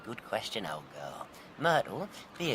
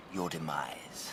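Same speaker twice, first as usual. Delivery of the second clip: slow menacing whisper; slow villain's whisper of doom; 7 semitones lower, 7 dB quieter, much slower than usual. Acted. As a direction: breathe it out slowly, savouring the threat. Villain gloats at his victim.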